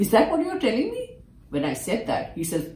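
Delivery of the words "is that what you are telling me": much higher, fast, disbelieving; can you believe this, pitched way up; incredulous. Retold or imitated. Incredulous protest to guru.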